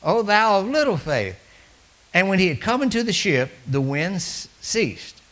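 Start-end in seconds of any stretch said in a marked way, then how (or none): 0.0-1.4 booming rebuke. Acted. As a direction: thunder the rebuke from the pulpit. Rebuke repeated.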